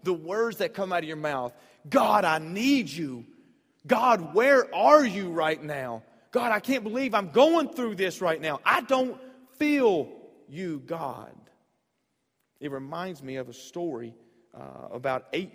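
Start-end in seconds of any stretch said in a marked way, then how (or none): 1.9-11.3 slow, pleading cry to God; desperate plea. Retold or imitated. Pastor voicing a desperate believer, pleading. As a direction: beg God, drawn out and louder.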